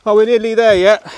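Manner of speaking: put-on asking himself like an impatient kid on a hike; mock whiny kid. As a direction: tease like a bored child in the back seat. Jokey kid question on long walk.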